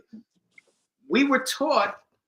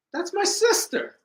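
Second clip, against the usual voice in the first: much higher, protesting; exasperated reply. Retold or imitated. Brother telling his jealous girlfriend who she is, high exasperated. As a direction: insist it with rising exasperation.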